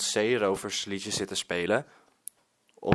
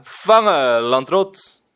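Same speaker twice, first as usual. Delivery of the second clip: loud, higher call down from the roof; pirate shout; 4 semitones higher, 11 dB louder, slower than usual. Acted. Pirate Willem throws the kite to the boy, loud shout. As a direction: bellow it down like a pirate.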